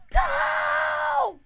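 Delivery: high, loud panicked yell at the van; desperate shouted go; frantic shout. Retold or imitated. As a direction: scream go at the driver. Stuck behind van, yelling.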